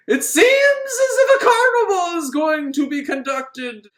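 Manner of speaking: high, loud spooky voice with a laugh; a mock ghostly announcement, laughing; eerie voice. Eerie carnival voice.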